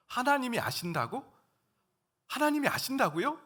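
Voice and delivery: surprised, wondering exclamation; amazed realization. Retold or imitated. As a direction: marvel as it dawns on you. Realizing God knows.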